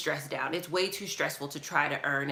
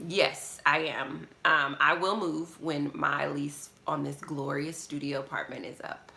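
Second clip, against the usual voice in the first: ordinary talk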